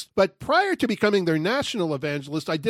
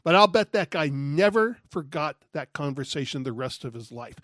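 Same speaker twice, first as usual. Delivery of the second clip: ordinary talk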